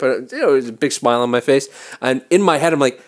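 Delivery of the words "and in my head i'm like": ordinary delivery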